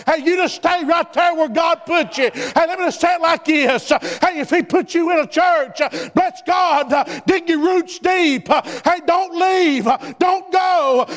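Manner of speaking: fiery revival preaching, urging them to stay in the ship; full-force preaching. Acted. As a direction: drive it home to the congregation, calling out.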